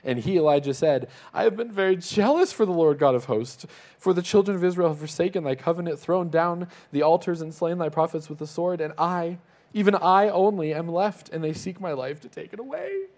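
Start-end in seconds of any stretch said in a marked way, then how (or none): 1.3-13.1 high, loud, complaining whine; bratty whiny voice. Acted. Elijah complaining to God, in a bratty high whine. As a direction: whine it like a spoiled kid sulking. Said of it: "read it in a bratty, whiny voice".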